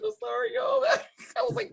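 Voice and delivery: very high, squeaky laughing apology; helpless laughter. Real, not acted. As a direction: apologize through uncontrollable giggles. Apologizing while cracking up.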